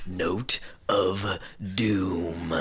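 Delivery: slow ominous whisper. A narrator's reading. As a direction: breathe the title like doom. Announces ominous chapter title.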